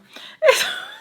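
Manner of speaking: high-pitched burst of laughter; real laugh. Real, not acted. Laughs while retelling closed door.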